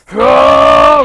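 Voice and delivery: a sudden, huge, low roar of a yell; mock battle scream. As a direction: bellow it like a fighter powering up. Drunk friend screaming, charging at me.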